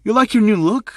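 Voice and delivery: high, loud, taunting sass; very sassy. Acted. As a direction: tease them with a sassy lilt. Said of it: "in a very sassy tone".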